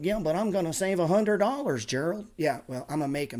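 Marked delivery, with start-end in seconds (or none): 0.0-2.3 high, loud whiny mock of a penny-pincher; high mocking imitation of a viewer. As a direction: sneer it like a small-minded saver. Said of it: "I'm like".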